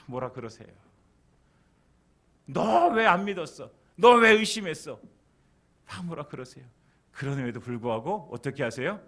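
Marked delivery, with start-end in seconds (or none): 2.5-5.0 loud, high scolding with drawn-out words; acted rebuke. Jesus rebuking sinking Peter for doubting, loud acted scolding. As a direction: raise the voice as if telling someone off.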